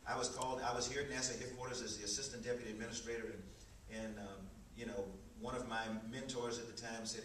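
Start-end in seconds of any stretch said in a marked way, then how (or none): none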